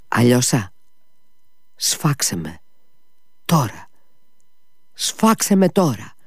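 rising from a hushed plea to a high anguished cry; sudden anguished cry. Acted. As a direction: beg him to end it, building to a cry. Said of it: "αναφώνησε ξαφνικά".

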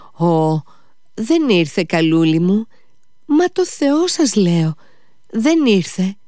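high-pitched, frantic denial; pleading servant. A narrator's reading. Fenya swears to Mitya that she never came, pleading.

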